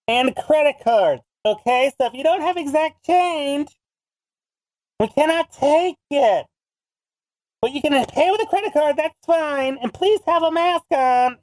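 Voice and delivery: high-pitched imitation of a public announcement; announcing to a crowd. Ice cream kid to waiting customers, announcing. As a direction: call out the rules to the whole line. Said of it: "announces to the whole crowd".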